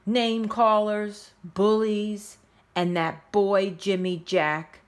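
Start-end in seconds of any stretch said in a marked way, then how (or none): none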